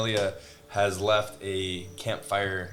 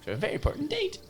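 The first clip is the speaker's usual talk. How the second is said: high, fretful late rabbit; 10 semitones higher than usual. Pretend. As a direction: fuss about being late.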